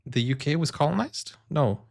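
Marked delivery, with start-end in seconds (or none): none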